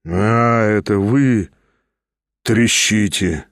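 low and slow, drawn out; dazed recognition. A narrator's reading. Stavrogin only now notices Pyotr, low and slow. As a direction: surface slowly from a daze.

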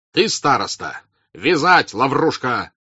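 loud, abrupt commands; shouted order. A narrator's reading. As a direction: shout a question, then snap out the order. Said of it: "кричал Ростов".

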